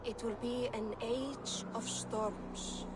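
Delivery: slow, drawn-out foreboding words; slow ominous prophecy. Acted. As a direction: let each word hang heavy with doom. Norn prophesies doom, slowly.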